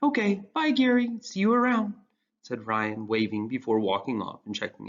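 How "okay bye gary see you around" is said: high, bright friendly goodbye; cheerful teen boy. Acted. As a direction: wave him off cheerfully.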